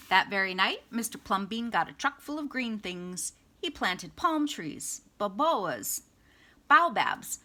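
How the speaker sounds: plain reading voice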